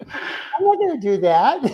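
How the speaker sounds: slow, laughing through the words. Real, not acted. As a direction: chuckle the line out slowly.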